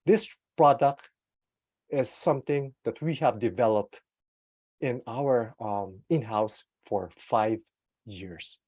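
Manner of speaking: slow, weighty product line; slow down to make it matter; deliberate slow presentation. Shown as a demonstration. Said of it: "when you slow down".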